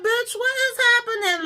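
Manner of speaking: very high, loud, held-up squeal of disbelief; shocked outburst. Retold or imitated. Storyteller reliving her shock at the gossip, high-pitched disbelief. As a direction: shriek it in mock bewilderment. Retells own shocked reaction.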